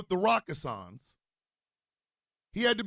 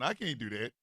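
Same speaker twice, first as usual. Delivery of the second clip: ordinary talk, nothing marked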